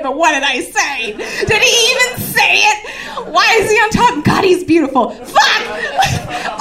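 high, loud comic freak-out; hammed-up stoned panic; overwhelmed panic. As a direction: wail it through laughter, spiralling. Acted panic while high, overwhelmed.

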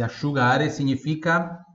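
ordinary talk, nothing marked

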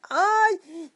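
ordinary delivery

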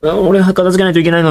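put-on whiny kid. Kid protesting cleanup he didn't cause, mild whine. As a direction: grumble like a small kid stuck tidying. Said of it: "みたいな".

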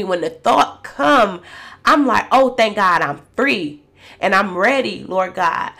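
fervent, tearful plea to the Lord; impassioned prayer. Retold or imitated. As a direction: cry out to God for freedom. Retold altar prayer.

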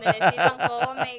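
dicho lento y fuerte, riendo; dicho entre risas. Retold or imitated. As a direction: remata el refrán con una carcajada. Citando sus dichos de TikTok. (el dicho de TikTok, lento y riendo)

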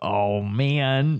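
ordinary talk

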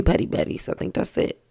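whispered teasing Petty Betty; whispered aside. Real, not acted. Teasing a viewer in a whispered aside. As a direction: breathe it out as a sly whisper.

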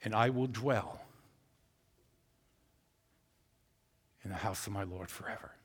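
dropping low and soft to "forever"; soft, fading quote from the psalm; hushed psalm. Acted. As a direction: let it fade into reverence. Quiet psalm reading.

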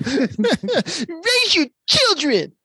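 very slow, high, laughing caricature; exaggerated cartoon intonation. Shown as a demonstration. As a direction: drag it out high, laughing through it. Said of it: "taking all the bass out of your voice".

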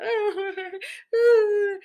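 sollozo agudo y fuerte, exagerado; llanto imitado. Retold or imitated. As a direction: imitar un berreo colectivo. (llanto fingido, agudo y alargado)

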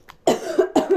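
coughing after swallowing wrong; choking cough. Real, not acted. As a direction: choke and cough it up. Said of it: "Hab ich verschluckt". (verschluckt husten)

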